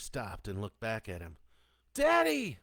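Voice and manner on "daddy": put-on high, loud child's scream. A narrator's reading. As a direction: scream for Daddy as he falls. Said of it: "they screamed in unison".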